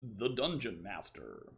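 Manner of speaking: slow, mock-grand title announcement; goofy theatrical voice. Streamer goofing over his guidebook, slow theatrical voice. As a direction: draw it out in a silly put-on voice. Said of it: "I'm in a goofy mood today".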